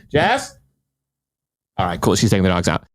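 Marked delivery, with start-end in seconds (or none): 0.1-0.6 higher, questioning call. Real, not acted. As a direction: call a name to someone in another room. Calls to someone at home.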